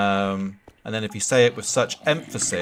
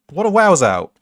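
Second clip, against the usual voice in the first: exclaiming; 6 semitones higher, 9 dB louder than usual. Pretend. Mock exclaiming at degenerate.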